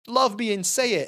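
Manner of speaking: hint of a man barking an order; mock yell. Retelling Terry yelling at Edie, mild mock yell. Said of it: "yelling, like".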